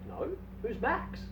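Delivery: a slow, high, quiet voice for the stranger; stranger's reply. Acted. Island stranger answers Dave, slow and high. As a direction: drawl the question softly, puzzled.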